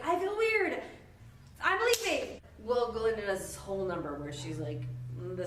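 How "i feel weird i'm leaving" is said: high, loud, abrupt mock line; awkward exit. Elphaba flees Glinda's makeover, high and abrupt. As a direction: bolt out of the room awkwardly. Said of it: "Elf was like".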